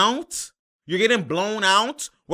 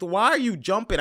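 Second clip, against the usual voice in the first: ordinary talk, nothing marked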